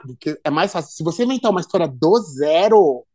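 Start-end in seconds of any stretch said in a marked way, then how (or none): none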